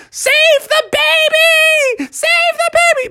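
very high shrieked plea; frantic high-pitched rescue call; panicked mom. Acted. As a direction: cry out in falsetto alarm. Mom hops after swinging baby.

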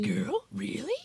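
high campy drag queen drawl; man doing a woman's voice, drag diva; Lady Bunny impression. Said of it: "that was my Lady Bunny impression".

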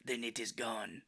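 smoky Zen master. Retold or imitated. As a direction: murmur it like an old sensei. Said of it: "It's a smoking voice".

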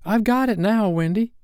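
put-on high, triumphant boy's call; excited cry. A narrator's reading. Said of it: "cried John".

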